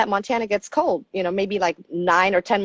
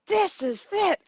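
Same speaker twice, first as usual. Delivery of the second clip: high, dreamy mock rapture; imitating enthralled husband; 10 semitones higher than usual. Husband to wife about his dream cabin, high mock rapture. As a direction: gush like a husband in love with his find. Voicing husband thrilled by the shack.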